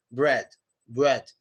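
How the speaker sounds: loose tongue on the R of "bread"; relaxed-tongue R. Shown as a demonstration. Teacher answering on tongue position, relaxed R. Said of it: "Your tongue can be relaxed".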